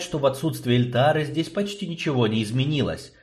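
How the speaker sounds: usual speaking voice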